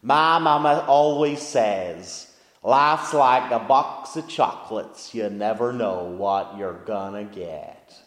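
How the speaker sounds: put-on slow Southern US accent, drawn out; Forrest Gump Southern accent. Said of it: "I should try the accent for this".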